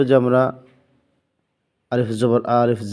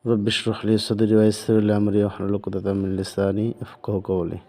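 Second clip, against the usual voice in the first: recited prayer; 3 semitones lower than usual. Real, not acted. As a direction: intone the dua calmly before teaching. Opening Arabic dua before lesson.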